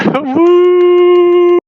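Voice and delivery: high, loud woo; cheering whoop. Real, not acted. Co-host whooping at his own introduction, high and loud. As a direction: whoop like a crowd.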